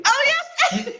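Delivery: usual speaking voice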